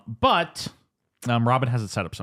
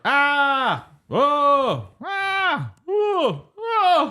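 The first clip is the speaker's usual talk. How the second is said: mock cries; 3 dB louder, much slower than usual. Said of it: "you're just like".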